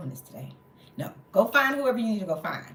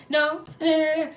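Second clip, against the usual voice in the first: higher, louder mimicked string of noes; interrupting refusal; 9 semitones higher, 6 dB louder than usual. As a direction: cut in over someone, imitating a busybody. Mimics lady cutting her off.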